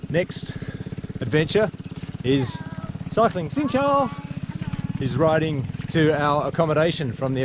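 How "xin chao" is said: cheery greeting. Real, not acted. Greeting locals while cycling past.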